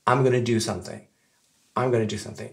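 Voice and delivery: "I'm gonna do something" run together casually, gonna for going to; reduced gonna. Shown as a demonstration. Teacher previewing a later pronunciation, casually reduced. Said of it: "on peut effectivement prononcer".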